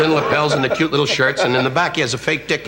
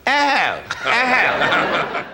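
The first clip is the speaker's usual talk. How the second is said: mock genteel laugh; 8 semitones higher, much slower than usual. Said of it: "she was sitting there quietly having her martini going".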